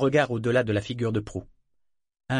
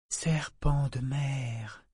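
slow, much softer, drawn out; soft repeat; 5 dB quieter, much slower than usual. Acted. Amber repeating the words softly to Paragon, slow and quiet. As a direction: repeat it slowly and softly, in wonder. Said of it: "répéta-t-elle doucement".

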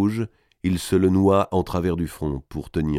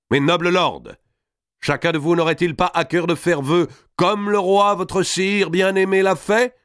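high, loud-edged declamation, climbing to the question; grand medieval herald's appeal; rousing orator; 9 semitones higher, 3 dB louder than usual. Acted. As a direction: challenge the whole table to swear. Robert rouses the lords to vow.

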